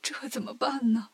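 soft, tearful acted lament; narrator's mock sobbing monk; crying. A narrator's reading. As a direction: whimper it helplessly, voice dropped. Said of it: "唐僧哭着说".

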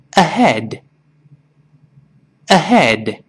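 slow ahead. Shown as a demonstration. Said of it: "先慢慢读这个词".

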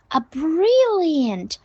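"brilliant" stretched long, pitched high then falling; exaggerated stress. Shown as a demonstration. Teacher modelling praise for mom, exaggerated stress. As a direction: swoop slowly through "brilliant". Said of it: "把brilliant也要发得很夸张".

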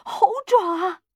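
wild high cry; loud, high, violent outburst; frenzied shout. A narrator's reading. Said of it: "他狂暴的叫嚷".

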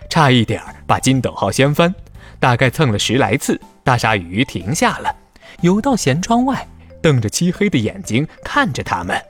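normal talking voice

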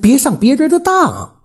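high-pitched, insistent pleading; unwilling to give up. A narrator's reading. Widow urging him to reconsider, unwilling to give up. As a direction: plead hard, voice pitched right up. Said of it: "虎子妈还是不甘心".

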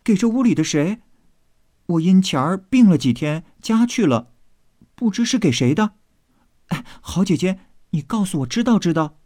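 high-pitched curious maid; eager hurried asking. Acted. Maid begging her sister to tell, high and eager. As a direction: plead quickly to be let in on it. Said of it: "秋文忙问".

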